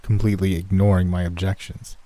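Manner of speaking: ordinary delivery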